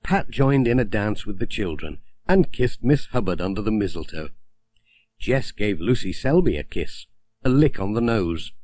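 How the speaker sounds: usual speaking voice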